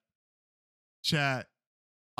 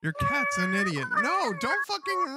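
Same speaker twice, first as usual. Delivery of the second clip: much higher, cracking into a shriek; shrieking mock rage; 4 dB louder than usual. Streamer screaming at a friend's cat, shrill hammed-up shriek. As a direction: screech at the cat like it insulted you.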